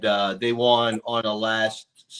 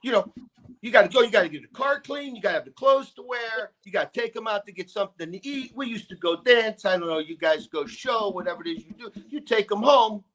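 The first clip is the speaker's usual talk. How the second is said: high-pitched rattling father, laughing at the end; dad lecturing his son on dating, high and exasperated; imitating ranting dad; 8 semitones higher, 3 dB louder than usual. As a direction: list the hassles fast, pitched up.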